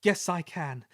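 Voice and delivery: a touch of brave determination. Acted. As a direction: start to find courage. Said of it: "he puffed bravely".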